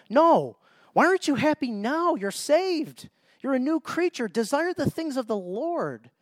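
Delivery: urgent preacher's challenge; emphatic rebuke. Real, not acted. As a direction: call them out, voice climbing high.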